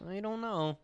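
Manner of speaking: high-pitched, mock-helpless reply; lightly squeaky shrug; mock unsure. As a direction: raise the voice in pretend uncertainty. Acting out own baffled reply.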